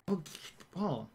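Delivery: ordinary delivery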